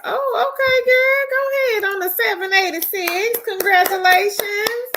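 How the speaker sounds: high-pitched, delighted cheering on; real high, gushing congratulations; excited congratulations. Real, not acted. Cheering a viewer's DoorDash earnings.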